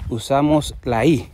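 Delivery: ordinary delivery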